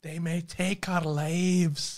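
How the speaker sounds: very slow, hammy Scottish movie speech; solemn mock-Scottish proclamation; bad Scottish accent. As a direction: stretch each word in a put-on Scottish accent. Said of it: "I can't even do a Scottish accent".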